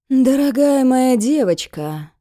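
slow, loud, sugary sneer; mock affection. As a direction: greet her with false tenderness. Villain sweetly greets victim.

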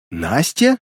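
mild gasp of recognition, rising question; astonished gasp. A narrator's reading. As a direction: ask it in stunned disbelief. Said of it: "ахнула она". (изумлённо ахнув)

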